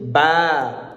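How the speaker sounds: "ba" with the vowel stretched out long. Shown as a demonstration. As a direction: draw out the a in "ba". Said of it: "pour tirer certaines lettres".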